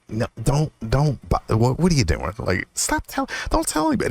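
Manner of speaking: rising, pleading no, don't; panicked protest. Retold or imitated. Protesting to the calming feeling, panicked and high. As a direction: beg it to stop, pitch climbing.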